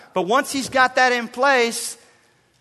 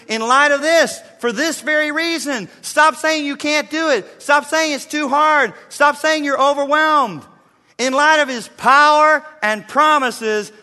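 higher, louder stop-saying commands; rally them to action; preaching at full force; 6 semitones higher, 4 dB louder than usual. Acted. Climactic preaching charge.

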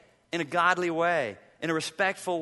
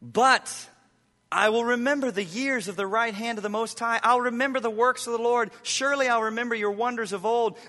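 higher, louder, pleading psalm reading; impassioned scripture reading; 7 semitones higher, 5 dB louder than usual. Acted. Pastor reading Psalm thirteen to his church, impassioned plea. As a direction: cry the psalm out to heaven.

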